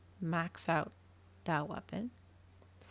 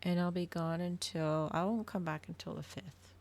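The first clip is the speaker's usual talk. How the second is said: ordinary talk, nothing marked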